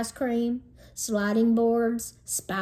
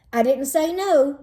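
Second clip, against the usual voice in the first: ordinary delivery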